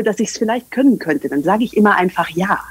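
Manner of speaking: ordinary delivery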